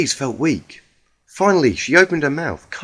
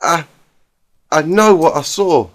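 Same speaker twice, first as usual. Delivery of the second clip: tearful; 3 semitones higher, 5 dB louder than usual. Acted. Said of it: "tears running down her cheeks".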